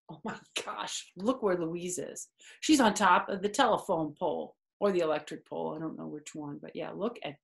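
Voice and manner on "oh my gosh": usual speaking voice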